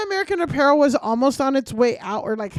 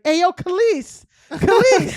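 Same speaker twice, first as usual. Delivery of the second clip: high, louder catcaller call-out; 6 semitones higher, 5 dB louder than usual. Retold or imitated. A catcaller hailing her on the street, calling out loud and high. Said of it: "this guy's like".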